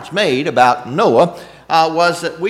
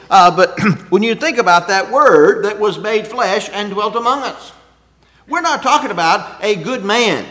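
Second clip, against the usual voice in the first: louder, driving sermon voice; fervent preaching; 3 semitones higher, 5 dB louder than usual. Acted. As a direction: preach it out with rising force. Preaching builds to full force.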